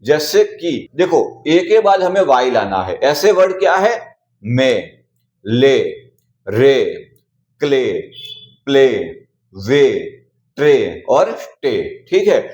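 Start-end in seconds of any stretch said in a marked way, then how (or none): 4.4-12.1 मे, ले, प्ले, स्टे said with a long "ay" vowel; each word said slowly and separately, lower in pitch; ay sound demo. Shown as a demonstration. A teacher drilling the A-plus-Y rule: a clear long "ay". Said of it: "ए को ए पढ़ते हैं".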